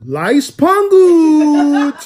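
high, loud mimicry of his friend's catchphrase; imitating Icy. Host mocks his friend's catchphrase in a high, loud voice. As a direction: copy her "Life's good" way up high and loud. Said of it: "That's how she says".

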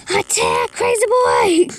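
big high shout. Pretend. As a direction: yell it out at the game. Cheering pet on in game.

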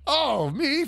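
high squeaky granny voice; little old lady voice. Retold or imitated.

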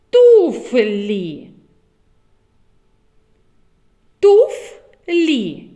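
Туфлі called out loud and clear; loud clear word. Shown as a demonstration. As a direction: call Туфлі to the class. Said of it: "зловити звук Ф в словах". (голосно Туфлі)

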